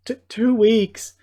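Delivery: high, loud, slow stammer of surprise; can't believe the sentence is so light; stunned disbelief. Acted. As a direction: repeat "two weeks" in astonishment.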